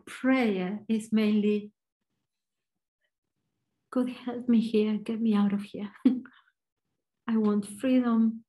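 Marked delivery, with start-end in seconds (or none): none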